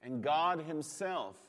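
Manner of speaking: ordinary delivery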